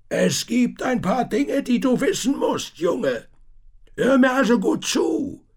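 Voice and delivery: put-on an old tinker leaning in to warn; a worried old tinker; old tinker's urgent voice. A narrator's reading. Tinker speaks to Thomas.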